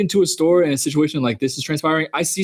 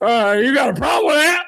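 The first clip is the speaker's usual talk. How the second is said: imitating the old man; 9 semitones higher than usual. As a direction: throw the challenge back like a grumpy old man. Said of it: "his vibe of just".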